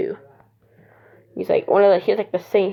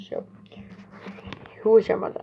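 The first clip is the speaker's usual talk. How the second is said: ordinary delivery, nothing marked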